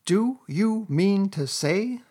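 high, slow, incredulous cry; excited. A narrator's reading. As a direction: draw out the question in excitement. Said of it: "cried the excited rat".

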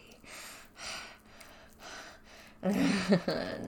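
a long breathy chuckle; airy laugh. Acted. Calling her bratty.

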